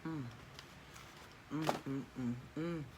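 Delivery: savoring mm sounds while chewing; mukbang eating. Real, not acted. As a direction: hum with a full mouth.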